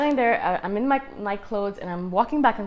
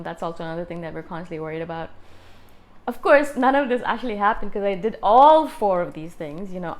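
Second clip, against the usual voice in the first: usual speaking voice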